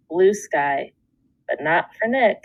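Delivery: ordinary delivery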